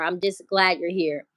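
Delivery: normal talking voice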